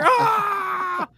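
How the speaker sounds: high mock scream of a victim. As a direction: scream like you are attacked.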